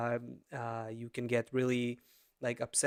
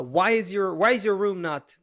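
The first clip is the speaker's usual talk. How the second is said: mock-angry dad, a bit louder and higher; 4 semitones higher, 6 dB louder than usual. Dad testing his son in a game, playing angry. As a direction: put on a mildly cross father's demand. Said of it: "the angry kinda".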